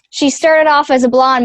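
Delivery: normal talking voice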